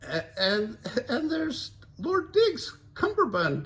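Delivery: high Daphne pointing out; excited find. Acted. Daphne voiced.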